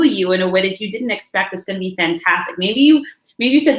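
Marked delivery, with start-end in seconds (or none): none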